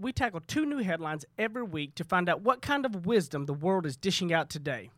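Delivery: upbeat promo read. Acted. Podcast intro read.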